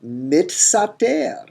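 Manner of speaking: mitsater with t before s, mit-sater; expected form mitsater. Shown as a demonstration. Teacher sounding out the expected form, mitsater. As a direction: say it mitsater, t first then s. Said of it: "we expect a form mitsater".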